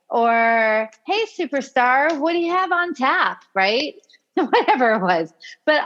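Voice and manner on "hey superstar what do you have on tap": high-pitched, high-energy greeting impression; so excited. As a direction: imitate someone thrilled to be talking to you. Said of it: "sounded just like she was so excited".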